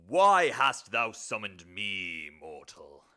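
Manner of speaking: loud, slow, drawn-out demon bellow; summoned demon. Acted. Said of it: "This will be the demon".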